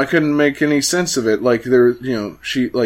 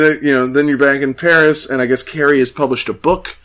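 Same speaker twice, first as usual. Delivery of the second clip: usual speaking voice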